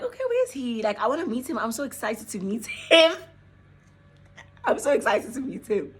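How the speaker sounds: a hint of a giddy friend. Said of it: "she was like".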